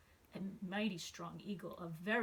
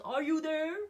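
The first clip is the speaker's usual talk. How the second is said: louder, higher call; 7 semitones higher, 8 dB louder than usual. Acted. Horton calling into each clover, hopeful call. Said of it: "and called".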